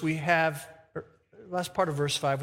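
ordinary talk, nothing marked

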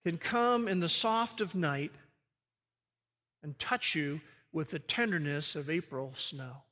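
softly tender. Acted. Tender image of God's gentleness.

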